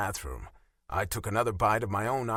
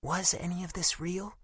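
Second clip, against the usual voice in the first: put-on higher, hurt young woman's voice; a wounded girlfriend, raised in pitch; Bella's voice; 10 semitones higher than usual. A narrator's reading.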